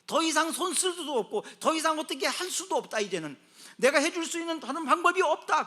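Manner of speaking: loud, high retelling of doctors; impassioned preaching. Acted. Preacher retelling doctors' verdict to the congregation, loud and impassioned. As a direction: declare the hopelessness forcefully.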